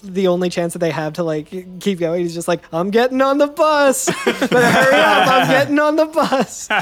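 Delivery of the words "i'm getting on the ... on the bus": laughing brash Bender voice; Bender impression. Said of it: "Bender's like".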